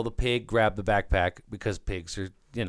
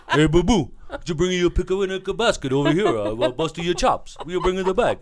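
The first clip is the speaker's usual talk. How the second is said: Italian bear voice; 6 semitones higher than usual. Pretend. Host voicing the bear in a mock Italian accent. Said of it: "He's more Italian than that".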